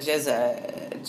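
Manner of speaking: usual speaking voice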